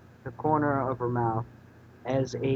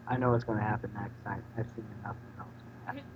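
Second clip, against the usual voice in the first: fast aside; 4 semitones lower, 8 dB quieter, much faster than usual. Real, not acted. Reader aside, knowing.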